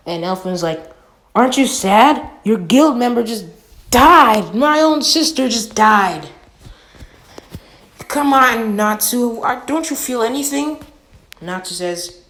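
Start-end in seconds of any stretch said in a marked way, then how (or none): 1.3-10.8 loud, raised, grieving reproach; upset outburst. Retold or imitated. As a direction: yell at him for not caring, voice pitched up. Elfman confronts Natsu about sister's death.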